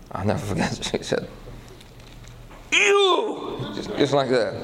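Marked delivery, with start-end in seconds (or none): 2.7-3.7 high piercing "You!"; startled woman shrieking You; shrieked accusation. Retold or imitated. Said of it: "she began to scream".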